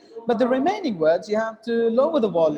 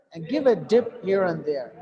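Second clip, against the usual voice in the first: ordinary delivery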